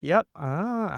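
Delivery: high, breezy ah okay mm yep; mock filler noises from a co-host; mock backchannel noises. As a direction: imitate a co-host murmuring agreement. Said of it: "the other one's just going".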